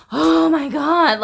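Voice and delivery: mock despair, drawn out; a retold distressed cry. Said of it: "so distressed".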